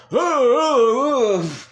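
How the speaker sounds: high, loud anguished cries; mock anguished screaming; pained yell. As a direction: let out a strangled cry of despair. [name] grabs his neck.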